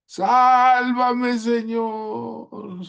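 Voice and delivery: high, loud cry for help; high pleading yell for rescue; desperate cry. Acted. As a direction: mimic a drowning man's plea. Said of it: "te pareces a una hermana".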